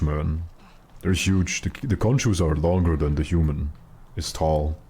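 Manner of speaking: usual speaking voice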